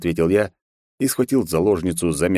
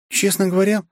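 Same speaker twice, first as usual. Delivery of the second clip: shy; 13 semitones higher than usual. Acted. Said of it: "застенчиво ответила вампиресса".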